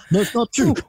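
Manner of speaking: high-pitched politician impression; Obama. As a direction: mimic a president's measured denial. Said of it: "How about Obama?".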